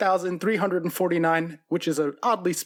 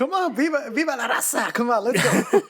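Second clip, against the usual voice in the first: excited cheering; 5 semitones higher than usual. Real, not acted. Cheering on a player's good score.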